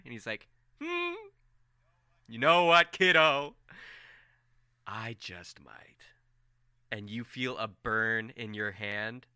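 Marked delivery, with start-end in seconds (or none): 0.8-3.5 high, loud playful drawl; teasing Tori. Acted. Tori teasing Farron before his reveal, high and loud. 4.9-6.0 soft, very slow, sinister; ominous murmur. Acted. Tori ominously answering Farron, soft and very slow.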